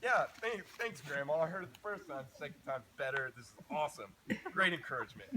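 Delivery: loud, high mock gratitude; sarcastic. Silently thanking grandma for repeating herself, loud sarcasm. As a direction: lay the sarcasm on thick. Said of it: "in my head, I'm like".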